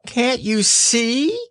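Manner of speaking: high, drawn-out Piglet cry; shouted. A narrator's reading. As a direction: shout in a tiny creature's high voice. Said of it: "shouted Piglet".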